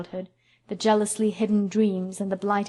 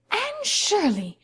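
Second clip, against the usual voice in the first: high, drawn-out cry of surprise; surprised exclaim; 12 semitones higher, slower than usual. A narrator's reading. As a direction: gasp out her name in astonishment. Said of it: "exclaimed Marilla".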